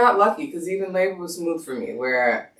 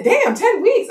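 very high-pitched amazement; mom's astonished echo; 4 dB louder, slower than usual. Retold or imitated. Mom echoes her daughter's news, high astonished voice. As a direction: imitate mom echoing in shock. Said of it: "She said".

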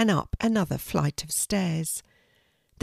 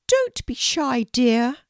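gracious royal lady, voiced much higher; a kindly Queen welcoming a guest, pitched well up; 6 semitones higher, 4 dB louder, slower than usual. The Queen inviting a shy girl to tea, high regal voice. Queen puts Kelty at ease.

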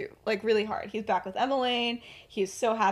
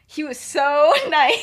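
slow, higher, laughing fake praise; mock sweet; 6 semitones higher, 11 dB louder, slower than usual. Lily fake praising Vernon, slow and laughing.

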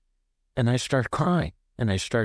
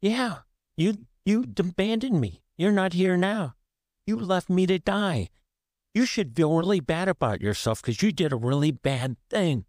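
higher, accusing voice throws blame at mom; angry accusation; 8 semitones higher than usual. Retold or imitated. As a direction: let the old hurt rise into a high, pointed charge. Retold phone call accusing mom.

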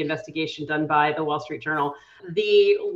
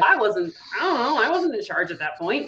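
higher-pitched mock excuse; evasive chair; 4 semitones higher than usual. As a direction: imitate someone dodging the blame. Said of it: "and said".

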